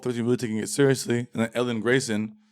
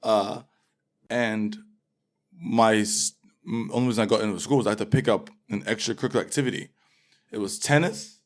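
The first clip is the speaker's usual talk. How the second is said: ordinary talk, nothing marked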